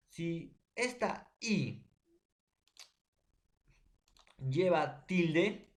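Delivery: drawn out, each word stretched and weighted; slow emphasis. Shown as a demonstration. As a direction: spell out the condition slowly for the class. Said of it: "teacher stresses the key condition". (lento y enfático)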